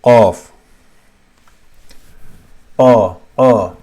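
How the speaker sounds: Qaf and qa popped loudly at the top of the throat; hard full qaf. Shown as a demonstration. Teacher drilling the sound source, loud full qa from the throat. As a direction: hit each qa hard, far back. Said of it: "on the top of the throat".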